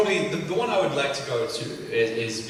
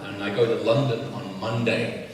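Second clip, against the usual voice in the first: usual speaking voice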